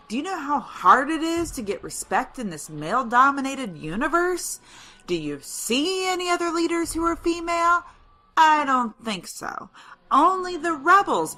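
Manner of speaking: rising, high-pitched indignation; indignant. Acted. Rebel leader protesting sexism to a reporter, indignant. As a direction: argue your case with mounting pitch.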